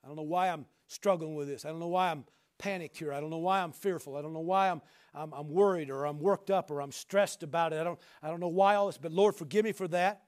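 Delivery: a hint of anxious pleading; humble prayer. Shown as a demonstration. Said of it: "you say".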